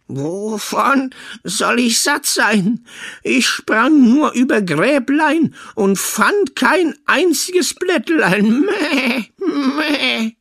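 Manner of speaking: high, slow bleating goat voice. Acted. The goat tells the son it is full, bleating. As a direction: stretch it out like a goat.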